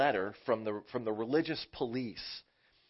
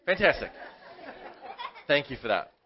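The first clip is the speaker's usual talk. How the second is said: laughing; 5 dB louder than usual. Real, not acted. Laughing reply to a kid's joke.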